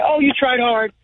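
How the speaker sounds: high-pitched mock praise; imitating overly forgiving fans; mocking polite fans. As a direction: console the failed player with exaggerated niceness. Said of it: "make fun of the Saint Louis Cardinals fans".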